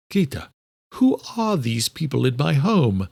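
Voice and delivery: slow, irritated demand with mild edge. A narrator's reading. Elderly man questions Gita about strangers in his home, irritated. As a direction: draw the question out, clearly irritated. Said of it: "clearly irritated".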